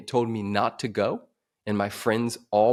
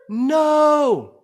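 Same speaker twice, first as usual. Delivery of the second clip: loud, high, drawn-out scream; 9 dB louder than usual. Retold or imitated. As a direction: scream it out in horror at a crash. Cry heard as woman is hit.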